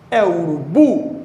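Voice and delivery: "urubu" slow, with a strong, rising BU; testing final stress. Shown as a demonstration. Teacher testing the stressed syllable, "urubu" stressed on final BU. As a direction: try "urubu" as a question, leaning on the last syllable.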